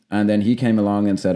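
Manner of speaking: ordinary talk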